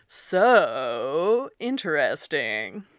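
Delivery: slow, drawn-out sarcastic "So interesting"; dripping sarcasm, very slow; deep sarcasm. Shown as a demonstration. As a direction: stretch "So interesting" into a lilting sneer. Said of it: "the pitch of your voice when you say something in, like, really deep sarcasm".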